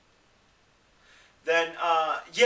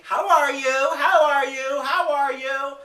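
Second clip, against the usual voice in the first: high, louder, singsong parroting; mock repeated greeting; 8 semitones higher, 6 dB louder, slower than usual. Imitating everyone asking him how he is, in a high parroting voice. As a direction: rattle it off like every nagging customer. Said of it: "every other person's asking me".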